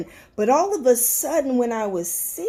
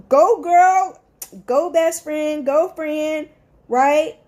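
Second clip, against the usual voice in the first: put-on loud, high hype-girl cheer, drawn out; hyped cheering; 4 semitones higher, 5 dB louder, slower than usual. Best friend cheering a friend's win, loud and hyped. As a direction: cheer her on like the biggest fan.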